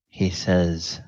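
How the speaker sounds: ordinary talk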